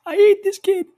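mock-furious shout. Real, not acted. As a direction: explode at your late cohost in mock rage. Host exploding at late cohost.

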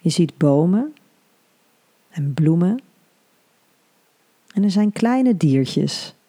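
soothing hypnobirthing guide; guided meditation. Acted.

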